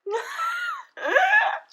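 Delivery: high screaming laugh; shrieking laugh. Real, not acted. Shrieking at toxic waste candy.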